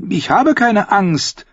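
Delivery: put-on high, drawn-out boyish protest; high-pitched child insisting, affronted; indignant. A narrator's reading. Boy answering his cousin's reassurance, indignant. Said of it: "sagte Edric entrüstet".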